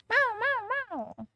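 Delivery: quick string of high mews; high-pitched pretend cat; cat meow. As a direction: meow like an eager kitten. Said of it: "I am on my cat form today".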